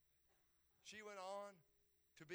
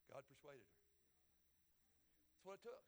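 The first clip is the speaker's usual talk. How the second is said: ordinary delivery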